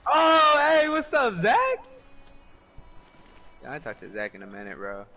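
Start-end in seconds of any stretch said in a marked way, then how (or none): 0.1-1.6 high, loud playful hello; excited greeting. Real, not acted.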